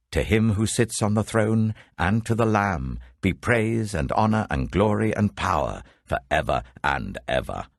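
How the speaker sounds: ordinary delivery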